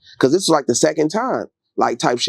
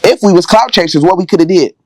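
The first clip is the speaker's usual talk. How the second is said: louder, fired-up storytelling; loud and worked up about the story; heated; 7 dB louder than usual. Real, not acted. Telling friends about the balcony night, loud and heated.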